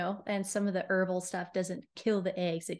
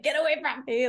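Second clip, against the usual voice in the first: higher, louder playful dismissal; mock rejection; 6 semitones higher, 5 dB louder than usual. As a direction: shoo them off in mock disgust. Acted dismissal of reluctant people.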